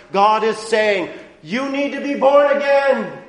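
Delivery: loud, rising pulpit preaching; impassioned preacher; full-force preaching. Acted. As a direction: drive the law's demands home from the pulpit. Voices God's promise.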